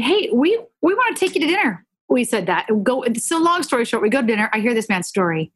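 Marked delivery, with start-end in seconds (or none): 0.0-1.8 bright and much higher, fast; friendly invitation to the neighbor, said much higher and fast; cheerful invitation. Retold or imitated. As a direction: pitch it up high and quick like an eager invite. Retold invitation to difficult neighbor.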